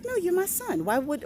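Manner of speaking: ordinary delivery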